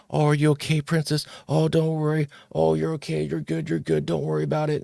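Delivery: high, sing-song comforting coo; cooing at a little girl. Retold or imitated. Adults fussing over a girl who fell, high cooing. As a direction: soothe a fallen princess with a mock coo. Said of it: "Everyone rushes".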